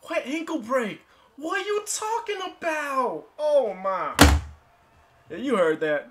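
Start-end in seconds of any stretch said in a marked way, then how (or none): 0.0-4.1 high, louder indignant protest; high-pitched outraged questioning; outraged disbelief. Real, not acted. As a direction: demand an answer from the game. Protests the ankle break call.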